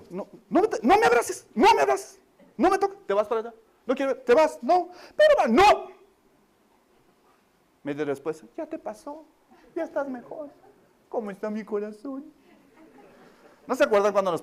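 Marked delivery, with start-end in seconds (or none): 0.1-5.9 put-on much higher and louder, pushing the child away; angry rejection. Parent refusing the child's hug, angry and loud. 8.6-12.2 higher and much softer, coaxing; soothing parent. Retold or imitated. Parent making up with the child half an hour later, softly.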